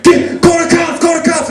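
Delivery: high-pitched urgent yell; retold scream. Screaming at his friends for police, urgent yell. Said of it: "So I scream at my friends".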